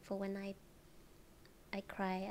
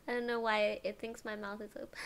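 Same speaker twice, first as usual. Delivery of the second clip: ordinary delivery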